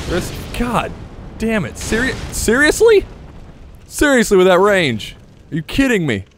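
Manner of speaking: outraged disbelief. Real, not acted. Outraged at enemy range.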